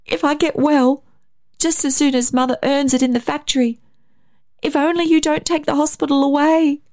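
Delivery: put-on high, pleading child's voice; near tears. A narrator's reading. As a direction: beg as a boy close to crying. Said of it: "very near to tears".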